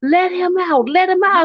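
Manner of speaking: high, pleading repeated cry; frantic demand. Retold or imitated. Wife at the bedroom door, high frantic demand.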